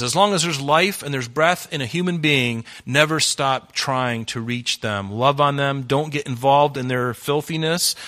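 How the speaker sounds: preaching at full force. Acted.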